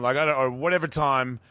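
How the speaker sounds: ordinary delivery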